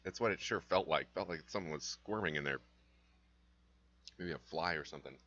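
throat irritated by a bug; talking through a throat tickle; just swallowed a bug. Real, not acted. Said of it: "I think I inhaled a bug".

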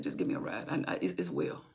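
low, soft, half-breathed words; a quiet, secretive reply to her husband; hushed reply. Retold or imitated. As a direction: keep it hushed, brushing him off. Wife hushing husband's questions.

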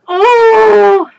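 high, loud cry of joy; delighted squeal. Real, not acted. Holding up her skirt, a loud delighted cry.

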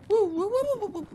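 very high falsetto woo squeals; shrill frightened woo-woo; panicked squeal. Real, not acted. Scared gamer squeals in panic.

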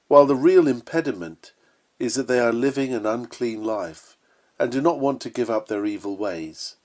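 normal talking voice

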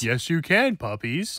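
soft, very low grandfather voice; grandpa. Acted. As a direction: answer as a grandpa, deep and quiet.

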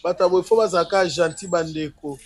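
ordinary talk

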